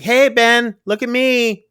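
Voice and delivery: high imitated little-girl call; retold child's attention call. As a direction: call out high, like a kid wanting watching. Child calls for attention in retelling.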